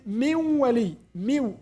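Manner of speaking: min wali run together as miw; merged miw. Shown as a demonstration. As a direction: drop the noon, say miw. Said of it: "you don't pronounce noon at all".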